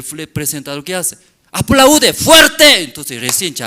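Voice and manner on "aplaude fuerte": slow, high, loud order to clap; commanding presenter. Retold or imitated. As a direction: bark it out, stretching each word.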